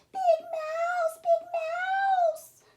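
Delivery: high squeaky little mouse voice calling out. Acted. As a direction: call for help like a tiny scared mouse.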